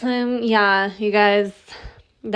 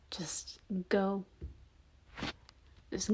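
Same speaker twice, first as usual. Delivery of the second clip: soft hushed push to leave; whispered urging; 9 dB quieter, slower than usual. Acted. Bob telling the listener to leave, whispered.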